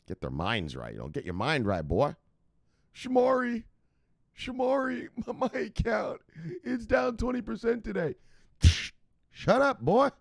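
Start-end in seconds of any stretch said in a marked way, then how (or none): 2.9-8.1 high whining complainer, lightly laughing; whiny viewer. Retold or imitated. Panicked newbie viewer whining to Shamari about losses. 9.3-10.1 mock snap. Host snapping back at viewer.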